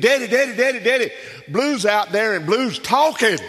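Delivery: put-on a scared boy bursting in to tell his dad; panicked excited boy. Boy shouting to his father about the talking mule, high and frantic.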